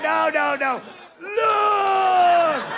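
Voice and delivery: high, loud hammed-up protest; mock pleading shout. Injured victims shouting back at the onlooker, mock-desperate. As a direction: wave off the onlooker, half laughing. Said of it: "They're going".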